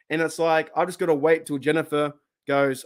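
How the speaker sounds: usual speaking voice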